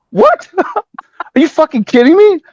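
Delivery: can't believe what he just heard; shocked disbelief. Retold or imitated. Told he's the video's guitar player, stunned laughing disbelief.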